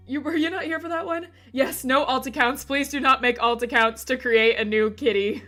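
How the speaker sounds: normal talking voice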